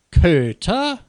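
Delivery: loud, high, rising cry of outrage; acted incredulous fury; enraged shout. A narrator's reading. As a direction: fling the word back as a furious question. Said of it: "schrie Frau Xavier wutentbrannt".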